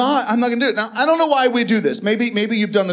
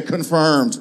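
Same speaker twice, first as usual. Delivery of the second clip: deadpan demo; 6 semitones lower than usual. Shown as a demonstration. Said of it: "Look at your neighbor, just say".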